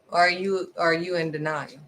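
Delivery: ordinary talk, nothing marked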